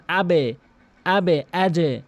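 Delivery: আবে and আজে bounced toward e; downward qalqala. Shown as a demonstration. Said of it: "নিচের দিকে ধাক্কা". (আবে, আজে নিচের দিকে ধাক্কা)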